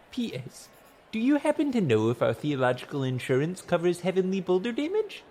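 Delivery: high-pitched, politely pleading letter voice; earnest petitioner's letter. A narrator's reading. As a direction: read it as a humble formal petition. Narrator reads a petitioner's letter aloud.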